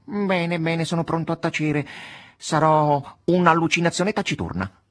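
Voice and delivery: a cat theatrically promising to behave; talking cat giving in. Acted.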